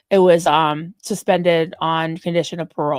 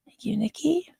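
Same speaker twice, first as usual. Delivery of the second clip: whispered thanks; soft breathy whisper, no voice; 3 semitones higher, 9 dB quieter than usual. Real, not acted. Softly thanking chat viewer.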